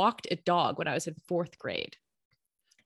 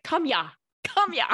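put-on "Come ya, come ya" in a playful Russian accent, higher and laughing; mock Russian accent; 7 semitones higher, 3 dB louder than usual. To the dog Richie, calling "Come ya" in a mock Russian accent. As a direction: do a light Russian accent on "Come ya", turning "here" into "ya". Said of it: "with a Russian accent".

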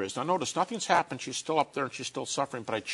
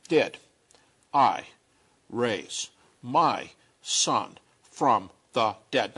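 word by word, long pauses between; very slowly; 2 semitones lower, 4 dB louder, much slower than usual. Acted. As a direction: spell it out slowly, each word separate. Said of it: "Okay, we'll go slowly".

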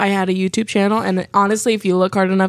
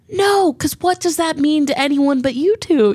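exasperated; 7 semitones higher than usual. Retold or imitated. Exasperated refusal.

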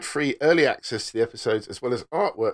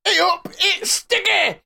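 loud high comic shout; silly high voice; 5 dB louder, slower than usual. Acted. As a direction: squeal it like a cartoon. Put-on voice for ad.

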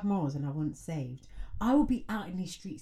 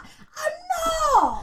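indignant yelp; 4 dB louder than usual. Retold or imitated. Retelling her own indignant reply.

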